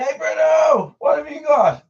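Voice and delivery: high, loud call to the dog; high happy shout at the dog. Real, not acted. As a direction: call out to Bruno, high and bright. Calling out to the dog.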